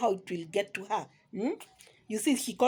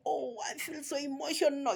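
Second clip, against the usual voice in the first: higher-pitched mimicry of an overcome visitor; mock emotional; 5 semitones higher, 4 dB quieter than usual. Vlogger mocking a guest's crocodile tears, mock emotional. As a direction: put on a whiny show of being moved.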